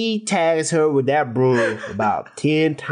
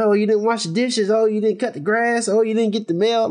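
higher mock nagging spouse; 8 semitones higher than usual. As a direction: nag like a fed-up married partner. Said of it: "like a old married dude".

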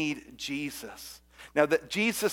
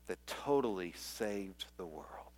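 whisper; 6 semitones lower, 10 dB quieter than usual. Real, not acted. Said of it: "whispered close".

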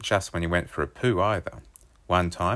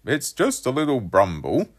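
high, loud innocent shrug of a line; dad brushing it off like nothing; underplaying dad; 7 semitones higher, 5 dB louder than usual. A narrator's reading. Dad downplaying his smelly fart to his son, high and innocent. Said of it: "totally underplaying the foulness of his fart".

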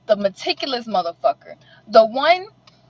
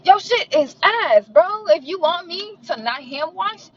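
higher, slower, sneering; higher slow mocking rant; mocking outburst; 9 semitones higher than usual. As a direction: drag it out in disbelief. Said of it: "I'm just like".

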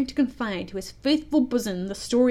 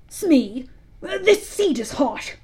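higher, surprised pirate captain; startled; 4 semitones higher, 4 dB louder, slower than usual. A narrator's reading. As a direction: yelp in sudden alarm. Hook startled by heat.

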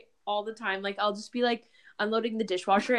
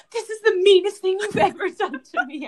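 high, louder mock whine; whiny accusation; 10 semitones higher, 4 dB louder than usual. Girlfriend retelling accusing her partner over the movie. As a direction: whine like a wronged child. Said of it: "literally was like".

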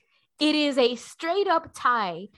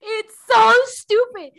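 higher, louder and slow; loud, drawn-out exasperation; exasperated outburst; 8 semitones higher, 9 dB louder than usual. Real, not acted. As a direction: spit out how stupid it is.

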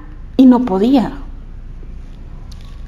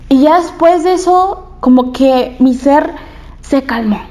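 ordinary delivery

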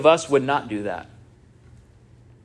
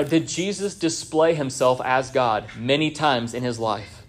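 ordinary talk

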